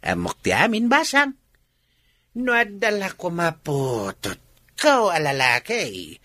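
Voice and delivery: put-on slow, drawn-out old man's proposal; old suitor. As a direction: spin the offer out slowly, savouring it. Old man proposes marriage, chuckling.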